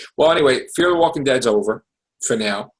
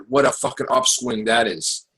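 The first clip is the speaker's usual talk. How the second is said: usual speaking voice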